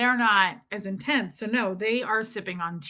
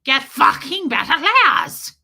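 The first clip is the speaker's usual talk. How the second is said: slow, loud, higher mock bark; intense coach voice; 5 semitones higher, 8 dB louder, slower than usual. Streamer mimics an intense person's barked order, slow and loud. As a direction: drag each word out with force. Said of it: "Sophia was like".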